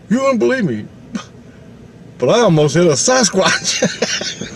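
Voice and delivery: high-pitched, amazed retelling; incredulous uncle. Retold or imitated. Uncle telling the family of a Sasquatch, incredulous. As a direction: act out breaking unbelievable news, voice way up. Uncle's astonishing announcement, acted.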